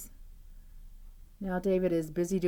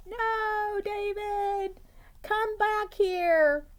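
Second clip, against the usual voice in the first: high, slow urgent mother calling; urgent mom; 4 dB louder, much slower than usual. Acted. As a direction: call him back with urgency. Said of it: "saying this with great urgency".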